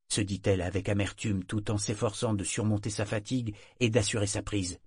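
ordinary delivery

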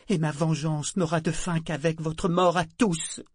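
réquisitoire indigné, plus aigu; matriarche outragée. Acted. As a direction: accuse avec colère contenue. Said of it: "celui d'une matriarche outragée". (colère de matriarche outragée)

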